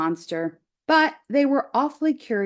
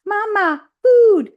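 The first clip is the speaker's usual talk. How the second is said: tiny gosling peeping high and loud; 12 semitones higher, 8 dB louder, slower than usual. Acted. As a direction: squeak it out like a hungry chick. Gosling cries out.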